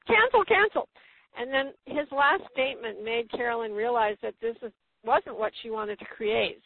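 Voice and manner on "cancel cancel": much higher, emphatic cancel. Retold or imitated. Carolyn finally rejecting the doctor, high and emphatic. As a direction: throw the words out with force. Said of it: "really thought".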